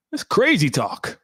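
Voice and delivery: high, drawn-out disbelief; incredulous. Real, not acted. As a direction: say it high and slow, appalled. Incredulous, before accented repeat.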